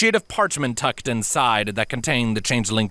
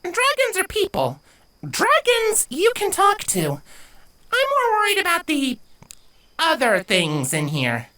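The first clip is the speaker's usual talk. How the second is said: put-on high, uneasy young girl; worried filly voice. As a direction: voice the worry, slowing down. Avia voiced, worried about the forest.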